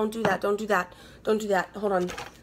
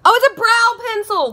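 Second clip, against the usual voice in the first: high and loud squeal of delight; excited; 8 dB louder than usual. Real, not acted. Discovering a brow pencil in the box, squealing with delight. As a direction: burst out with real delight. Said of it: "Wow, I'm so excited".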